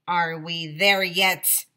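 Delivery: slow, loud grumble from a lightly voiced cat; serious cat. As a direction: lightly play a stern, impatient cat. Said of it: "the cat looks so serious".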